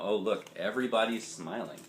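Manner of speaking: usual speaking voice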